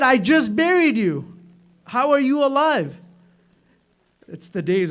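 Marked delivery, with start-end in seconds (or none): 0.0-3.0 high, loud cry of amazement; astonished cry. Retold or imitated. Husband facing his living wife, loud astonished cry. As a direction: blurt it out in wonder. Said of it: "Pata nahi umeed se bola ya hasrat se bola".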